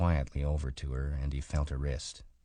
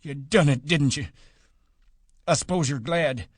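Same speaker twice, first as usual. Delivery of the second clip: much higher and louder, bitter accusation; bitter rage; 9 semitones higher, 5 dB louder than usual. A narrator's reading. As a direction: hurl grief and blame at her. Grieving old man berates dead woman.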